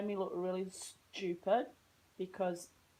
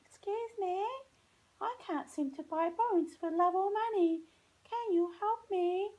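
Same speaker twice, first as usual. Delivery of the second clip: very high, prim and polite voice; a high, overly polite customer voice; polite request; 12 semitones higher than usual. Retold or imitated. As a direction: ask sweetly in a put-on prim voice. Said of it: "I didn't say it that way".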